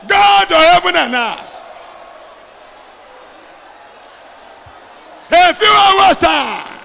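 shouted prayer, stretched and loud; preaching at full force. Acted. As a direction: pray it out at full force over the crowd.

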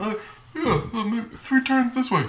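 high-pitched mock bluffing; put-on voice, feigning that it's broken; playing it off. Imitating a character who plays it off, high feigned cluelessness. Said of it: "kind of plays it off like it's broken".

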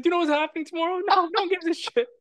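very high-pitched imitation of a back-and-forth; high, amused mimicry; mock family exchange. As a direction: act out the exchange in a squeaky-high voice. Acting out friend's exchange with mom.

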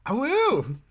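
high, loud wolf howl. Pretend. GM howls like a wolf.

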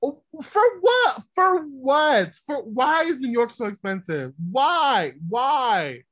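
high, loud, exasperated protest; exasperated outcry. Real, not acted. Complaining to friends about New York rents, loud and exasperated. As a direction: throw the question up loud and high.

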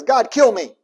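loud, high cry of mock despair; despairing plea. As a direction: plead to die, overdramatic and anguished. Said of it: "he basically says".

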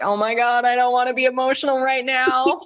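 high, squeaky self-quote; mock distress. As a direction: playfully whine it out, pitch climbing as you go. Acting out panic at own tears.

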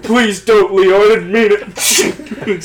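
mock pleading victim.

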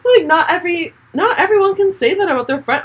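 high, squeaky talk through laughter; laughing. Real, not acted. Telling about a friend's reply, laughing. Laughing after retold line.

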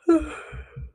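real breathy yawn. Real, not acted. Vlogger interrupted mid-routine by tiredness, yawning. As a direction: let out a yawn mid-thought. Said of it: "sorry, I yawned".

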